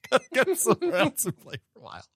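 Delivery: said through laughter; laughing mock quote. Joking as a footballer moving on after the World Cup, laughing. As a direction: crack up while acting out the quote. Cracking up while voicing Haaland.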